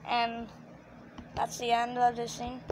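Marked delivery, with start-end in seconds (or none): none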